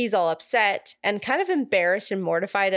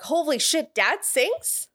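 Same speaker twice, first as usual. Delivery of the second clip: high, rising incredulous questions; mock astonishment; 6 semitones higher than usual. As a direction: imitate kids amazed by their dad. Acts out children amazed at Dad singing.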